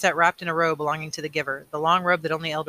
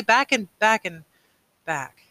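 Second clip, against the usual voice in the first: trailing off; 5 semitones higher, 5 dB louder, slower than usual. A narrator's reading. Boy repeating a familiar phrase, voice trailing away. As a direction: let the repeated word fade away slowly. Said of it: "His voice trailed the familiar phrase".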